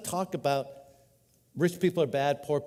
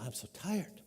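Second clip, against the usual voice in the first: much softer, drained little sigh of a mom; exhausted mom; 12 dB quieter than usual. Retold or imitated. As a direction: let it sag out like a worn-out mother. A worn-out mom answering.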